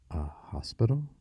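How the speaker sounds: "hospital" lifted high in a hesitant question; halting question. A narrator's reading. Boy realising where he was brought, halting rising question. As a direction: let it come haltingly, rising high at the end. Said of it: "Corey's words came slow".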